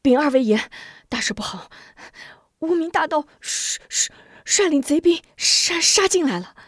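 high, stammering alarm; panicked report. Acted. A messenger rushes in to warn the generals, stammering in panic. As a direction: blurt it out in a breathless panic. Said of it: "急匆匆跑进帐来".

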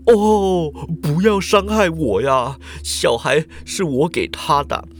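put-on old man's pleading wail, slow and drawn out. A narrator's reading. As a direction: beg for mercy with a cry. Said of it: "老人就哀嚎啊". (老人哀嚎求饶，拖长声音)

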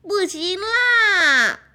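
high, slow wailing no; crying shout. Acted. Duckling wails at friends, slow crying shout. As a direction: wail it out in distress.

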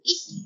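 high "eee" through clenched teeth; mock shriek, jaw clamped; demonstrated clenched-teeth shriek. Shown as a demonstration. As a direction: imitate a scream with teeth shut. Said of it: "那种声音".

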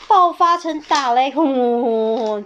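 大声夸张地喊出雷声; 像雷一样爆开来; 轰雷般大喊. A narrator's reading. 讲雷暴爆发. (大声夸张的雷暴)

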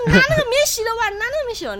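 put-on high anxious order to boyfriend; panicked instruction. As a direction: insist urgently in fright.

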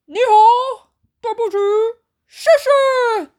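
high, loud bear voice; playful bear. A narrator's reading. Bear happily testing his three words. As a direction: toss the words out gleefully.

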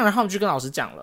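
normal talking voice

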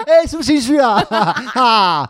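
high, loud, laughing taunts; childish teasing. Pretend. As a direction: tease like a child squabbling. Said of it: "怎么变成幼稚的吵架".

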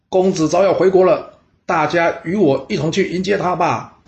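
rally the townsfolk to welcome the prince; calling to crowd. A narrator's reading. Addressing the city crowd. (高声号召百姓)

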